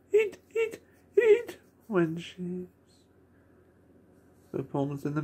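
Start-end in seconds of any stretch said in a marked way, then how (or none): 0.1-1.6 very high squeaked "eat, eat, eat"; squeaky chant. Retold or imitated. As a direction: squeak it like a cartoon creature. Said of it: "Bon Bon going".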